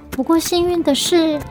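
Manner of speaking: plain reading voice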